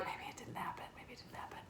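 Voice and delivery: hushed, voiceless whisper; soft, unvoiced whisper. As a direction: whisper it like a secret between diners. Customers quoted talking to themselves.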